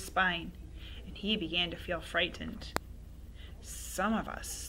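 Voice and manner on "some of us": soft, throaty witch whisper; old woman whispering. Acted. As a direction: breathe it like a sinister hag. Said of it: "whispering in a throaty sort of voice".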